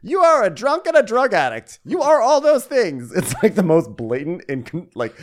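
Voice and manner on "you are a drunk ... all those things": high, loud shrieking Karen impression; mock her accusing in a shrill high voice. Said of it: "this clip was just Karen going".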